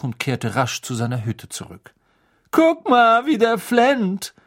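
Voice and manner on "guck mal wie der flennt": high, loud, drawn-out jeer; spöttisches Johlen. A narrator's reading. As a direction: taunt him for all to hear. Malfoy mocks the crying Hagrid. (hoch und laut höhnend)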